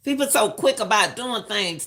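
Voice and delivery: ordinary delivery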